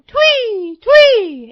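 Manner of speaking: very high, loud bird chirps; shrieked tweet like a little robin; robin's tweet. A narrator's reading. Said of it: "in his own voice".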